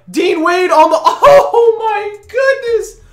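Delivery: loud, high, stretched-out disbelief with a laugh; amazed shouting. Real, not acted. Amazed at big play.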